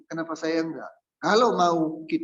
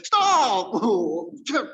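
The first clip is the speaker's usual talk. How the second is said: loud, high, abrupt "Stop"; brisk Batak command; 6 semitones higher, 5 dB louder than usual. Acted. Preacher as Batak parking attendant, abrupt shout. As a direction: shout it short and sharp. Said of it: "harusnya orang apa? ... orang Batak".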